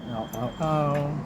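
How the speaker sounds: quiet pained yelps from hot fingers; burnt fingertips. Real, not acted. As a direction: wince through burnt fingertips. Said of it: "that's hot".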